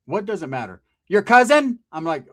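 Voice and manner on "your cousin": high, loud, incredulous question; imitating an irritated woman; scornful retort. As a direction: throw it back scornfully. Woman scoffs at caller.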